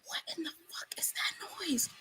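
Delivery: hushed, spooked question; alarmed whisper. Real, not acted. Alarmed by gunshot-like noise.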